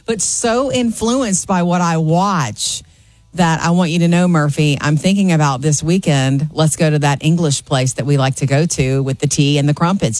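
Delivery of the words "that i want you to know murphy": usual speaking voice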